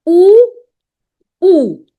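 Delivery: U as a long, loud "oo"; same pronunciation. Shown as a demonstration. Teacher showing both words sound the same, U as loud "oo". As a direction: sound U out strongly as "oo". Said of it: "now the pronunciation is same".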